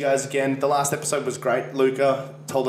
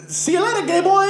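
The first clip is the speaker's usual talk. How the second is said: very high cartoon Italian accent; squeaky-high mock Italian game voice; 4 dB louder than usual. Retelling the game's victory line, high Italian accent. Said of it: "trying to do an Italian accent".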